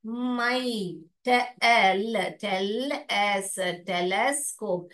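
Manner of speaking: telescope said very slowly; slow model reading. Shown as a demonstration. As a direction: stretch it out for kids. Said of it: "ऐसे ही पढ़ोगे". (धीरे-धीरे पढ़ना)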